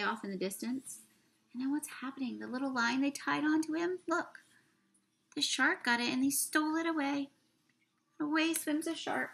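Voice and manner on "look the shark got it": ordinary delivery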